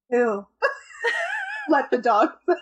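laughing through the line; giggling while singing it. Acted.

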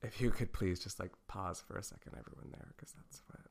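breathy late-night whisper; sultry midnight voice. Pretend. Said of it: "my sultry midnight voice".

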